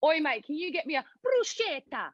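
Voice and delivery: Australian accent with a hammed-up Italian bruschetta; laughing, mock Australian accent; Aussie voice, Italian flourish on bruschetta. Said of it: "So it's like".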